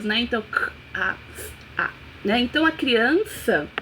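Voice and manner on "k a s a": sounds of casa spelled out one by one, k, a, s, a; k, a, s, a as separate phonemes; sounding out letters. Shown as a demonstration. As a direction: sound out k a s a, each sound apart, last ones nearly voiceless. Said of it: "sons diferenciados".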